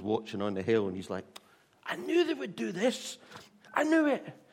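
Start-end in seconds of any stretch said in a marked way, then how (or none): 1.8-4.4 much higher, exasperated outburst; angry and annoyed. Retold or imitated. Jonah on the hill complaining to God, annoyed. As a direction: vent irritated frustration at God. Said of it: "he's so angry and annoyed".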